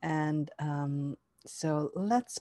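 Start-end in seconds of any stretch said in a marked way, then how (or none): none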